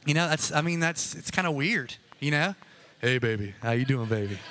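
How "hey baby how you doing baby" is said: low, smooth seducer's croon in speech. Retold or imitated. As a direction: drop deep and come on to her.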